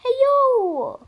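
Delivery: high, loud excited whoops; excited shouts. Real, not acted. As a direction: yell out in sudden excitement. Excited outburst while playing.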